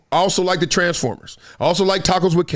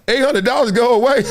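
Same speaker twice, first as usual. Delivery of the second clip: high, louder disbelieving echo; incredulous; 6 semitones higher, 3 dB louder than usual. Retold or imitated. Driver echoes the bribe back to the guy in disbelief. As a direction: repeat the offer in mock disbelief. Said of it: "I was like".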